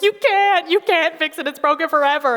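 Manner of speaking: high, loud mock child insisting; whiny child. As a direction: play the upset kid who won't be consoled. Child's Lego creation breaks.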